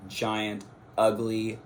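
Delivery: normal talking voice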